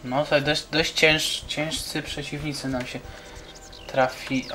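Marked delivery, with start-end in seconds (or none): none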